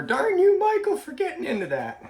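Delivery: high, loud mock yell, laughing at the end; mock yell at the donkey. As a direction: playfully shout at the donkey like an exasperated wife. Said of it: "she might be yelling".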